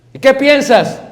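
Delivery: wife asking. Pretend. Wife voiced in marriage scene.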